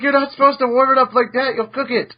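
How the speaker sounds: mock scolding.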